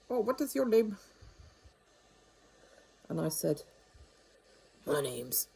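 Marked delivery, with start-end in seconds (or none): 0.1-1.0 higher-pitched imitation of an Indian-accented caller. Scam caller asking her name, imitated Indian accent. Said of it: "his accent probably suggested". 4.9-5.5 low comic phone voice; funny put-on voice. Giving the scammer a name, low funny voice. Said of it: "put on a funny voice".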